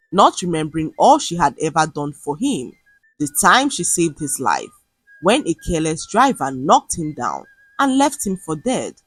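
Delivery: ordinary delivery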